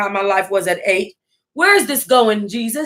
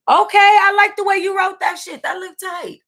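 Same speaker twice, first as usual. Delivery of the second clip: excited shout; 10 semitones higher than usual. Real, not acted. Reacting excitedly to a viewer's name.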